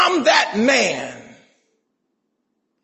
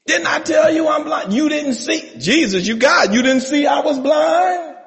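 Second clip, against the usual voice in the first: higher, exasperated complaint to Jesus; mock indignant blind man; 7 semitones higher than usual. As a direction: play the blind man protesting, hammed up.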